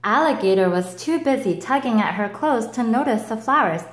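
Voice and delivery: usual speaking voice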